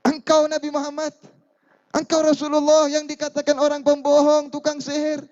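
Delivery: put-on high, accusing voice of the old woman; mother confronting the Prophet. Woman confronting the Prophet, high and accusing. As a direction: challenge him as the trembling woman.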